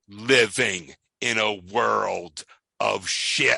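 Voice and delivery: very slow, word by word; drawn-out, weighty delivery; deliberate echo. Retold or imitated. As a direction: imitate the recruit, stretching out every word. Recruit's slow echo of the warning.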